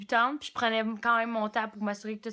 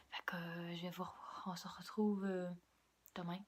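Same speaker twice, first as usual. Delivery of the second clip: soft late-night whisper; 4 semitones lower, 12 dB quieter than usual. Real, not acted. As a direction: hush it so nobody wakes. Late-night vlog, whispering to camera.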